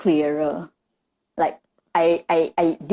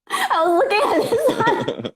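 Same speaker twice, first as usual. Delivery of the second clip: high, giggly confession; squeaky with amusement; laughing. Real, not acted. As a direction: tell it through real giggles. Giggles showing what they watched.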